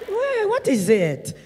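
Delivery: slow, exasperated phone greeting; tired annoyance at a late call; grumpy pickup. Retold or imitated. Answering late call irritably.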